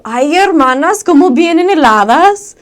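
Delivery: loud, high-pitched shouted Mexican welcome; yelling greeting. Retold or imitated. Mother of the house greeting cold missionaries, yelling. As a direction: belt it out as if angry, though only greeting. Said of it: "sounds like she's yelling and angry".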